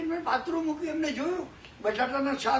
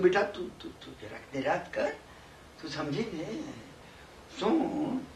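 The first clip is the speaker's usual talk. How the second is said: much lower, softer reassurance; calm her down gently, low and soft; low, hushed comfort for a crying girl; soothing; 9 semitones lower, 5 dB quieter than usual. Retold or imitated. Calming the crying daughter.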